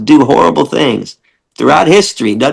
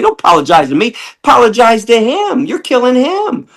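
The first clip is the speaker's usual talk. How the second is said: much higher, scolding push; high mock scolding; 10 semitones higher than usual. [name] telling visitors to apologize to the pig, high scolding. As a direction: chide them for the pig's sake. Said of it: "I'm like".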